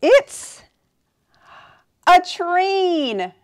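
loud excited reveal. Shown as a demonstration. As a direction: announce it with delight.

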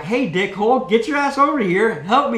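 high, loud demand for help; retold angry plea. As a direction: holler at a useless spotter. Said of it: "And now I'm like".

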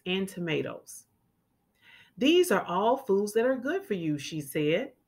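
clear, lively storybook reading; children's story read-aloud. A narrator's reading. Teacher reading a picture book to children, lively. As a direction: read it like storytime for little kids.

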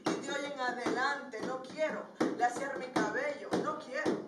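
proud spoken-word declaration, rising and punchy; emphatic, rhythmic verse to a crowd; defiant poem recitation. Acted. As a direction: throw the taunt back with pride.

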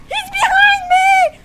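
very high panicked shout; scared screaming. Real, not acted. Player with monster behind him, screaming in panic. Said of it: "I scream like a fucking pussy".

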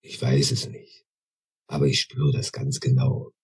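put-on quiet knowing old voice. A narrator's reading. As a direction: speak it with calm conviction. Sage senses the newcomer. (leise, tief, ruhig überzeugt)

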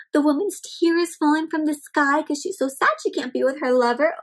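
ordinary delivery

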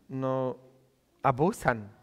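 ordinary talk, nothing marked

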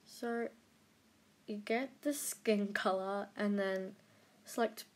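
ordinary talk

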